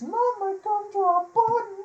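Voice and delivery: high, squeaky cookie quote; Gingerbread Man voice. Retold or imitated. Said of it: "the giant Gingy is hilarious".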